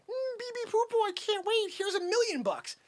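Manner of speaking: high-pitched gullible investor; mocking eager customer. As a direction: gush in a high voice, mocking the buyer. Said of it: "You're like".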